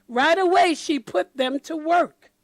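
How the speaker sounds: plain reading voice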